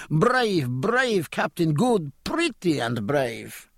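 high, slow gushing Mercedes voice; gushing praise. Acted. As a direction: pour out admiration, slowly and high. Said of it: "uttered a flood of Catalan".

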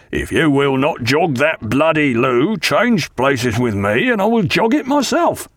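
exasperated order, climbing high at the end; deliberate, insistent demand that rises to a high finish; very loud. A narrator's reading. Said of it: "he said very loud".

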